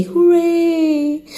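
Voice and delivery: high, long-drawn hooray; playful cheer. Shown as a demonstration. Podcaster rewarding listeners who stayed, playful cheer.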